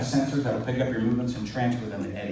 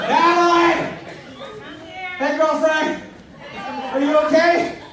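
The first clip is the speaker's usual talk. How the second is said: calling out; 6 dB louder, slower than usual. Real, not acted. Host calls out to guest.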